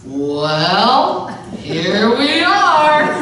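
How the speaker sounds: put-on awkward young husband laughing; a touch of a nervous chuckle.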